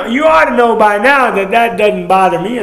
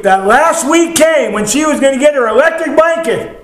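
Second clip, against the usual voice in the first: higher-pitched preacher's storytelling swell; building excitement; 8 semitones higher, 4 dB louder than usual. Real, not acted. Preacher telling of the last week before the blanket, excited build. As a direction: lift the pitch as the climax arrives.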